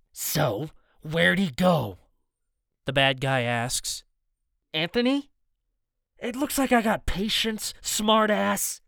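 taunting thug, sneering; menacing thug voice. Acted. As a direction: play it as a cocky bully. Villain interrogating, voiced.

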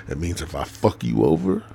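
ordinary delivery

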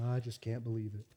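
low, very soft defeated mumble; mock beaten-down self-pity; whipped puppy. Believers moping instead of handling it, whipped-puppy voice. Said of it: "like a whooped little puppy dog".

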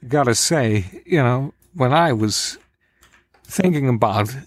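halting, slow phrases with odd pauses; Chris Walken. Acted. Actor musing about Australia on request, halting movie-star impression. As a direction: break the sentence into slow, separate pieces. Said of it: "Chris Walken".

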